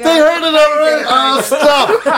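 high, helpless laughing exclamations; laughing protest. Real, not acted. Embarrassed at retelling story, laughing.

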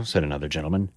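ordinary delivery

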